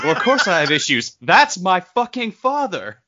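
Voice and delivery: high, loud mock outburst; laughing mock Star-Lord rant; Star-Lord quote. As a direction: yell it like a petulant space hero. Star-Lord impression, heated.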